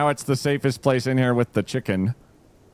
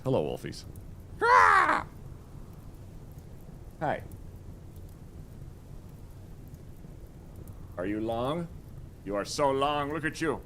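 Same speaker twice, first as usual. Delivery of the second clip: cooing to cat; 2 semitones higher, 7 dB quieter than usual. Real, not acted.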